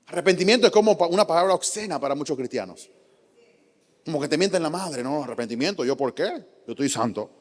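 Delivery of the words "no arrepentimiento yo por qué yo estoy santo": low, soft, smug believer brushing off repentance. Retold or imitated. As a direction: mutter it like a proud churchgoer insulted.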